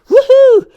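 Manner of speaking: high, loud celebratory whoop; excited woohoo. Acted. Volunteer whooping to cheer the mom on. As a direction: whoop with delight.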